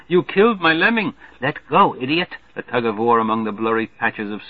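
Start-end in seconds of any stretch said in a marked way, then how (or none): 0.1-2.4 put-on high, cross little-girl voice; a shrill tug-of-war scold; little girl cursing. A narrator's reading. Little girl cursing the boy over her furs. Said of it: "lets out a flurry of curses".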